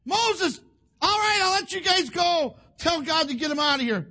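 high, loud cry of surrender; exasperated Pharaoh. Acted. Preacher plays Pharaoh giving in to Moses, loud exasperation. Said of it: "finally he says".

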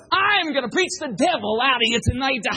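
loud, high fire-and-brimstone bellow ending in a gasp; raspy preacher. Retold or imitated. The preacher pointing at a young visitor, raspy threatening holler. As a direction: holler it like a breathless pulpit preacher. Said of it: "this real weird raspy tone to his voice".

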